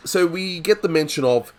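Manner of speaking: badly faked New Jersey accent; failed New Jersey accent. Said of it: "See, I can't do that accent".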